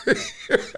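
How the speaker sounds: sharp high burst of laughter; quick squeaky laugh. Real, not acted. After his dare.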